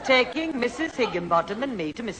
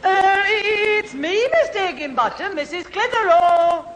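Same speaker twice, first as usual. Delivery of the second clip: put-on posh voice; 8 semitones higher, 4 dB louder than usual. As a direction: put on your best voice for the neighbour.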